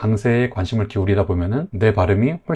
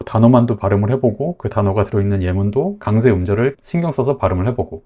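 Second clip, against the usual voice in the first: ordinary delivery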